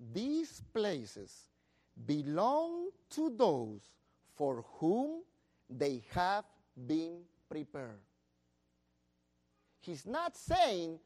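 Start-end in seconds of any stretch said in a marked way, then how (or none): 0.1-8.0 slow, deliberate reading; measured scripture reading; read slowly. Shown as a demonstration. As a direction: read each phrase slowly with pauses. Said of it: "I'm going to read this slowly".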